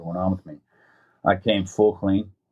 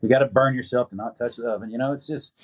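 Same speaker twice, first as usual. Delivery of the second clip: normal talking voice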